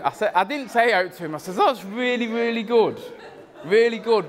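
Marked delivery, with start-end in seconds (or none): 1.6-4.3 high, laughing mock enthusiasm for a bad carving; fake praise. To Adam about his crap pumpkin, falsely impressed. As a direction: gush insincerely while holding back a laugh. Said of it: "But I thought, "Adam, what the hell are you doing?".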